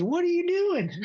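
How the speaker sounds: high, alarmed pilot imitation; pilot impression. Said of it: "that's how he talked".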